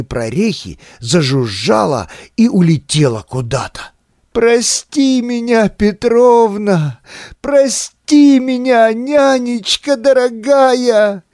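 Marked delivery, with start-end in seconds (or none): 4.3-11.2 tearful cry. A narrator's reading. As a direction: beg forgiveness through tears. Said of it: "кричал Володя, проливая из глаз ручьи слёз".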